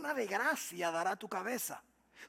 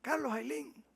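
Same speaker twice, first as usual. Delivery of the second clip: mock compliment; 5 semitones higher, 4 dB louder than usual. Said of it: "Si alguien nos dice".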